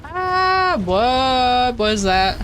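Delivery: high drawn-out "ah", then slow question; startled. Real, not acted. Streamer reacting to something in the game, startled.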